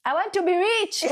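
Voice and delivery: high, bright playful claim; playful declaration. Acted. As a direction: say it like a cheeky wish. Playful answer with laugh.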